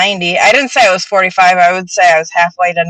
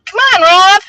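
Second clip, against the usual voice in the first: calling out; 13 semitones higher, 5 dB louder than usual. Real, not acted. Calling Rob to join.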